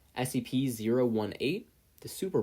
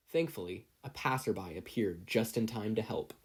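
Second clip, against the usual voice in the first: plain reading voice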